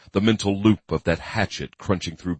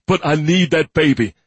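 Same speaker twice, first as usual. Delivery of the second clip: desperate cry; 10 semitones higher, 6 dB louder than usual. A narrator's reading. Desperate captive man.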